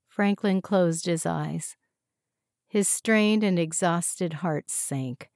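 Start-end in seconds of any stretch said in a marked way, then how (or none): none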